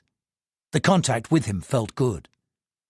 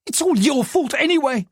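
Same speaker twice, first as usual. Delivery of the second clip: angry blame; 11 semitones higher than usual. Acted. Angry wife blaming.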